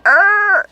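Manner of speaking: high squeal of laughter; squealing giggle. Real, not acted. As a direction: shriek with giggles. Giggle over the signed ticket.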